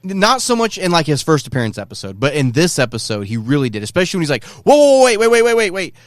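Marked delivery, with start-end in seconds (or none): 4.4-5.9 high, loud mock-panicked stalling; pitched way up, easing down by the end; frantic retold plea. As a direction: imitate someone urgently calling a halt. Retold plea before being killed.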